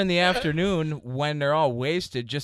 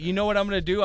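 ordinary delivery

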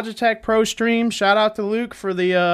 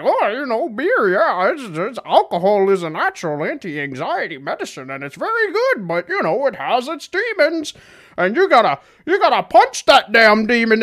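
high, swooping caricature of a psychologist; Jordan Peterson; 5 semitones higher than usual. Acted. Host mocking psychologist as closet alcoholic, high lilting caricature. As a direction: pitch it high and wobble up and down, hammed-up. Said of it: "Jordan Peterson said it about a hundred times".

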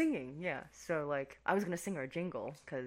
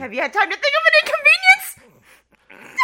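mocking; 18 dB louder than usual. Mocking partner's line.

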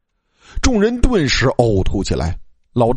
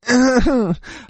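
high-pitched eerie laugh; ghostly crone cackle; 12 semitones higher than usual. A narrator's reading. Ghost granny facing the students, eerie high cackle.

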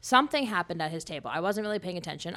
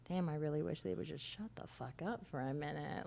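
low little mutter; 6 semitones lower, 12 dB quieter than usual. Retold or imitated. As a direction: lower and much softer under-the-breath mutter. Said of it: "a low little".

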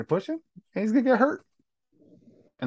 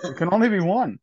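booming movie-trailer line, louder; dramatic Highlander quote; 4 semitones higher, 4 dB louder than usual. Retold or imitated. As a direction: proclaim it like the Highlander. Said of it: "this ain't the Highlander".

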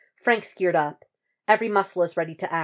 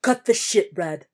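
angry demand; 3 semitones higher, 4 dB louder than usual. A narrator's reading. Frank tells Red to cut the shit, angry and raised. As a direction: cut him off with a sharp demand.

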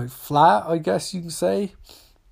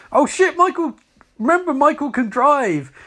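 surprised exclaim; 7 semitones higher, 3 dB louder than usual. Real, not acted. Reacting to Michael driving.

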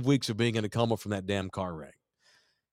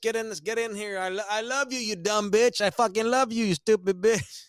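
mock drunk husband; 8 semitones higher than usual. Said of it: "He's like".

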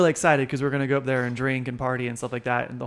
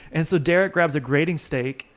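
usual speaking voice

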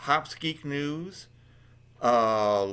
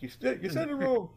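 high, fast mock scolding; lightly voiced annoyed friend; 13 semitones higher, faster than usual. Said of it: "she's gonna kill me".

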